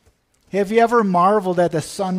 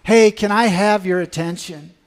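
mild yell; 7 dB louder than usual. Retold or imitated. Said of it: "and he is yelling".

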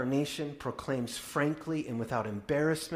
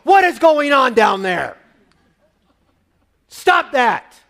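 loud, high holler downstairs; parent hollering; 11 semitones higher, 13 dB louder than usual. Retold or imitated. Parent upstairs yelling at squabbling children, loud holler. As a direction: yell down the stairs at fighting kids. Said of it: "holler at the top of your voice".

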